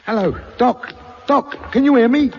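calling out. Acted. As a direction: call for someone who isn't answering. Calling over dead radio.

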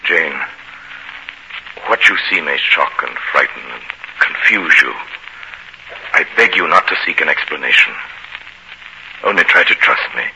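loud, insistent appeal; urgent plea. Acted. Master preparing Jane for a frightening sight, urgent plea. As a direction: press for trust, loudly and earnestly.

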